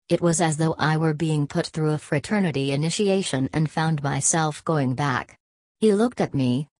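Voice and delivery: ordinary delivery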